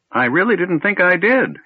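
put-on high, sheepish old wizard; apologising for simply surviving; apologetic old man. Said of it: "he said apologetically".

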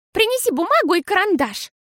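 high, firm bossy command; decisive. A narrator's reading. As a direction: order it with resolve. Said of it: "решительно сказала Фиасоль".

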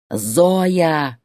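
drawn-out angry call; yelled. A narrator's reading. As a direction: bellow the name down the phone. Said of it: "опять заорал Борис". (орёт имя)

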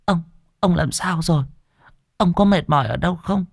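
high, anxious wife's voice; wife at the bedside, high and anxious; worried wife. Acted. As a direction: fret over him gently.

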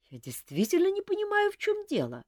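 lightly voiced, high and hurt; a touch of wounded pride, voiced high; offended young woman. A narrator's reading. Эльвира обиженно отвечает графине, чуть обиженно и высоко. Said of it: "обиделась Эльвира".

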